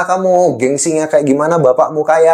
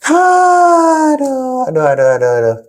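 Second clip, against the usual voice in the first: exasperated groaning; 10 semitones higher than usual. Real, not acted. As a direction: groan in frustration at the mess. Overwhelmed by caller's debt.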